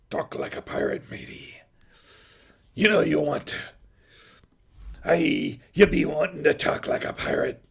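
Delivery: rough, hammed-up movie pirate; teasing pirate growl. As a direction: coax them like an old sea dog. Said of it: "hearing some feedback from my voice back there".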